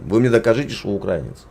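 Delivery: usual speaking voice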